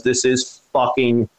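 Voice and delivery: slow, drawn-out stoner-style object voice; talking steering wheel. Retold or imitated. Storyteller as the hallucinated steering wheel, slow drawn-out voice. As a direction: stretch each word out slowly. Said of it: "the steering wheel looks at me and goes".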